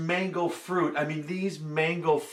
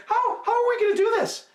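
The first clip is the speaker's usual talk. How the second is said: curious monkey; 5 dB louder than usual. Acted. Monkey asks, high voice.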